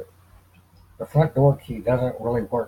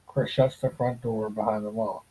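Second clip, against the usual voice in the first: usual speaking voice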